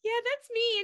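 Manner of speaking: a high, giddy "that's me"; giddy high reply. Retold or imitated. [name] confirming to Ashley, high and giddy. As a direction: answer up high, shy and thrilled. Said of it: "I was like".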